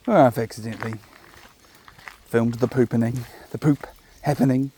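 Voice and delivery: ordinary talk, nothing marked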